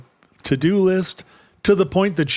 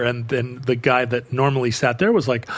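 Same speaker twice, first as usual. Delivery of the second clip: ordinary talk, nothing marked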